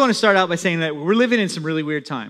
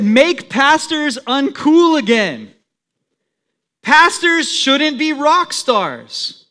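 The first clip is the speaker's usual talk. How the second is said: a bit louder, firm declamatory read; reading a statement aloud; 4 semitones higher, 3 dB louder than usual. Acted. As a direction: read the quoted message with conviction. Reads out a quoted message.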